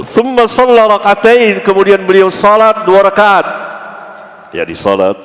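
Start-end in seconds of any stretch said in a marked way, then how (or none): 0.1-3.6 high, loud ringing delivery; emphatic recitation. Real, not acted. Preacher reciting and translating the hadith, ringing. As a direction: proclaim the text then its meaning.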